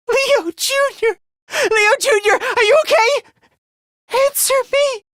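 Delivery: high, frantic pleading calls; panicked calling out. Acted. Calling Leo Junior, are you okay, panicked calling out. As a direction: cry out for an answer, voice pitched high.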